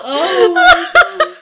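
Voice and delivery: laughing hard while talking. Real, not acted. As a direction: crack up through it. Cracking up over the line.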